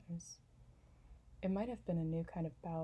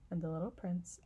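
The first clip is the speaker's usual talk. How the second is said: ordinary delivery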